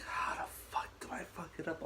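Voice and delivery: high, hushed disbelief; strained whisper of bafflement at yourself; whispered exasperation. Retold or imitated. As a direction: whisper it in mock frustration. Retelling frustration at failing a fight.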